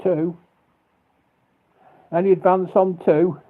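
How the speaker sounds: a hint of an auctioneer calling bids. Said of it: "I sound like an auctioneer".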